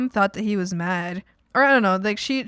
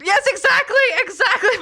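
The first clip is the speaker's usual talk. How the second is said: high, loud, laughing outburst; excited agreement; 8 dB louder than usual. Real, not acted. Chat viewer nails the focus problem, excited laughing agreement. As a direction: shout it out delightedly through laughter.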